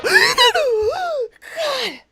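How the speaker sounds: startled shout. Real, not acted. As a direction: gasp into a high shout. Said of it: "I inhaled a bug".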